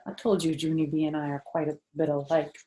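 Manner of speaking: usual speaking voice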